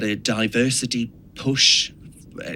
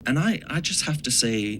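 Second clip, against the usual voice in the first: ordinary talk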